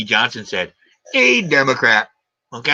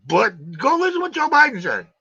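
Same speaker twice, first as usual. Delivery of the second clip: usual speaking voice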